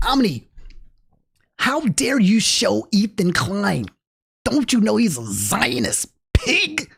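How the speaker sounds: slow, drawn-out scolding; mock outraged critic. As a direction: scold in a put-on voice, pausing for horror. Mocks outraged viewers scolding him.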